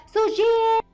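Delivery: loud drawn-out call of her name; narrator's hammed-up cry; shocked calling out. A narrator's reading. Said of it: "وتحت صدمته قعد ينادي عليها".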